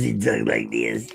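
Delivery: slow, put-on lawyer voice; pear-headed lawyer voice. Dad showing his kid how the lawyer talks, comic lawyer voice. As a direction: play a comic lawyer with an odd way of talking.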